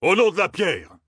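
a high shouted oath; called out. A narrator's reading. Captain bursting on deck, shouting. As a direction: call it out across the deck. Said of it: "lança une voix".

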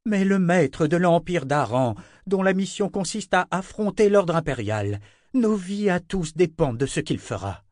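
Anna, insistent. A narrator's reading. Anna rebukes Zed, high and insistent. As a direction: drive home the stakes.

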